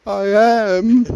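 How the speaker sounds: tiny high squeak, no force behind it; meek, high and without belief; timid mouse. Retold or imitated. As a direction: imitate mum squeaking it like a little mouse. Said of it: "Like a little mouse, see, with no belief".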